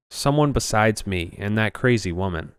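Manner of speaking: plain reading voice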